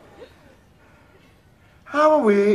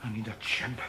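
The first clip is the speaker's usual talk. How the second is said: hushed whisper; 9 semitones lower, 17 dB quieter, slower than usual. Acted. Alone in cell, hushed aside.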